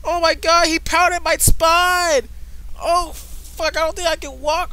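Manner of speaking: hammed-up high cries of pain, loud then easing; mock agony. Player pretending his spine got pounded, high mock-agonised wail. Acts out being injured in game.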